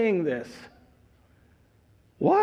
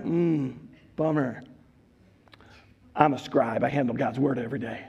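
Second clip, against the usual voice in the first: lower, softer, dismissive bummer then a quick brag; smug scribe; 7 semitones lower, 4 dB quieter than usual. Acted. Preacher voicing a scribe who sneers at a plumber, low and smug. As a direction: pity the plumber, then boast fast.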